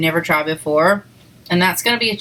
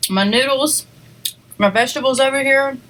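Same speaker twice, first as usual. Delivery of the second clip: normal talking voice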